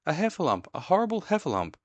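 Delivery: high, panicked little voice; terrified Piglet. A narrator's reading. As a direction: squeal it out in horror. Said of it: "cried Piglet".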